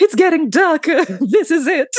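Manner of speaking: high, loud mock-dying melodrama; very dramatic. Co-host carrying on the fainting act, very dramatic. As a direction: overdo the dying heroine. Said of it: "it's all very dramatic".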